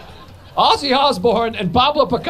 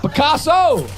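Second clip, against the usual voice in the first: Picasso! called higher with a hammed-up Italian flair, falling; mock Italian exclamation; 6 semitones higher, slower than usual. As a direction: proclaim Picasso! in a light fake Italian accent. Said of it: "I hear O, I think Italian".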